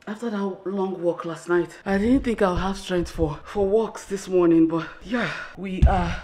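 out of breath after walking; breathless talk between gasps; panting. Real, not acted. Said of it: "I'm sorry I'm panting".

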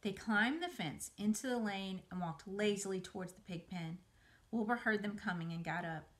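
plain reading voice

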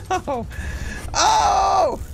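high panicked shrieking; scared horror game streamer. Real, not acted. Spider attack, panicked shrieks.

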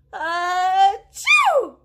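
loud high sneeze on chew; mock sneeze. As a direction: burst it out as a big sneeze. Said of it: "Was he sneezing?".

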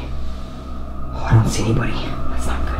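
hushed, breath-only nervous muttering; tense near-whisper while searching; fearful whisper. Acted. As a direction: whisper as if someone might be outside. Fearfully checking outside for intruder.

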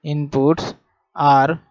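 each word drawn out slowly for note-taking; slow classroom dictation with pauses. Acted. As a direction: dictate it word by word so students can write.

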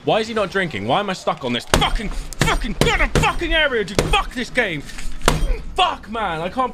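furious cursing; berserk rage. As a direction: swear loudly in frustration. Streamer cursing at stuck game.

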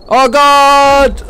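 high, loud alarmed cry; startled cry. Real, not acted. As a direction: cry out in sudden fright. Sudden danger in game.

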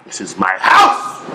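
slow, loud burst on HOUSE; angry assertion. Acted. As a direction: hammer it out, exploding on "house". Husband asserts authority in quarrel.

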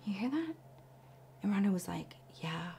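whispered, barely audible exchange; hushed whisper. Retold or imitated. Coworkers whispering while eavesdropping on a fight. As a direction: keep it to a breath so they won't hear you. Overhearing an argument through walls.

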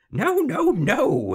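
very high, loud squealed noes; gleeful. A narrator's reading. Little man dancing at the queen's failure, gleeful. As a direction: crow the noes in mounting glee. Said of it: "he laughed and danced".

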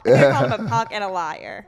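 ordinary talk, nothing marked

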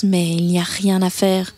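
plain reading voice

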